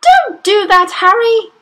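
high, loud protest; crying out. A narrator's reading. Mother protests when husband turns TV down, crying out. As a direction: shriek at him to stop. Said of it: "Missus Wormwood cried out".

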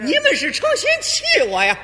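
high, loud accusing shout with a laugh; the warlord's mother blowing up at the actors; furious old lady. Acted. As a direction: hurl the accusation at them. Old lady explodes at the troupe.

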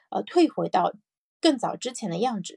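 ordinary talk

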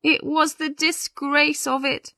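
high-pitched tearful bride. A narrator's reading. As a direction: confess it through tears of shame. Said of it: "Jane began to cry again".

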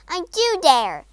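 loud, high, angry retort; furious defiance. Acted. Princess answers frog's dare in fury.

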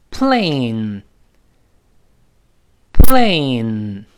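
loud, very drawn-out "plane"; 大声读. Shown as a demonstration. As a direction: belt out "plane", stretching the vowel into the n. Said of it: "我们大声读一下". (“plane”大声拉长读)

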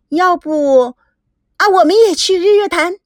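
high, eager, hesitant suggestion; reluctant to part. Acted. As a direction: angle hopefully to tag along. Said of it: "吴梦玲还有些依依不舍". (依依不舍地娇声提议)

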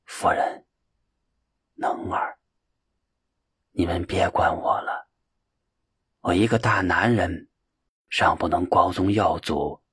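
desolate, breathy whisper, fading; a dying man's bleak faint whisper; dying words. A narrator's reading. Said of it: "极为苍凉地说道".

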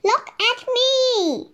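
high, bright-eyed storybook shout, slowly; Monkey calling out, show-off glee. A narrator's reading. Monkey on his skateboard calling to friends, excited shout. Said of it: "shouted Monkey".

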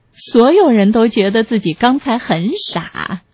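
ordinary delivery

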